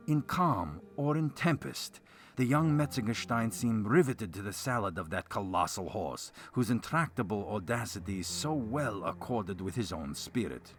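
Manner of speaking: ordinary delivery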